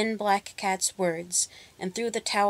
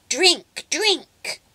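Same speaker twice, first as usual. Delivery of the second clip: much higher and louder commands; screaming witch; 9 semitones higher, 5 dB louder than usual. A narrator's reading. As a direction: scream orders at the cows. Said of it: "she screamed at them".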